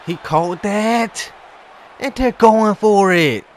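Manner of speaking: high, loud and slow cry of outrage; outraged yell. Real, not acted. Gamer outraged as the other team converts, slow loud yell. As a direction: drag it out in disbelief.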